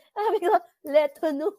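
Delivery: leto nu said high with a French lilt; lightly mock-French leto nu; playful French. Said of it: "Sabi ko nga palang nakakatawa".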